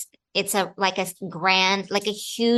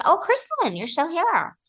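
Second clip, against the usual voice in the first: much higher, startled exclamation; mock surprise, pitched way up; surprised PA; 8 semitones higher than usual. PA finds the actress still on set, high surprise. Acting out surprised production assistant.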